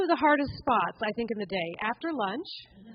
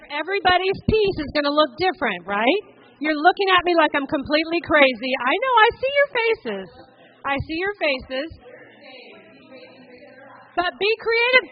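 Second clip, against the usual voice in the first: raised, much louder voice, pitched higher, carrying over the crowd; louder and higher, rallying a noisy room; calling out to the room; 5 semitones higher, 10 dB louder than usual. Real, not acted. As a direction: project over a room full of people on their feet.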